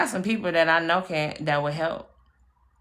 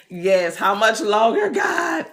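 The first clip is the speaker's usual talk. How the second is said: loud, rising plea to God; mock exasperated cry; 4 semitones higher, 6 dB louder, slower than usual. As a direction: call out to heaven in hammed-up exasperation. Acts out crying out to God.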